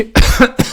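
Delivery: sudden sharp cough; coughing fit. Real, not acted. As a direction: cough hard mid-sentence. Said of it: "excuse me, I'm choking a little bit".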